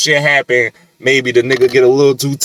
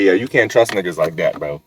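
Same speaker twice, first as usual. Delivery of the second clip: ordinary talk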